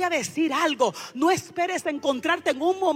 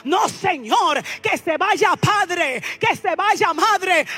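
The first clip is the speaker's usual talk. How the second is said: loud, driving sermon at full force; fiery preaching; 5 semitones higher, 6 dB louder than usual. Acted. Preacher stirring the congregation, loud fervent preaching. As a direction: preach it out with rising passion.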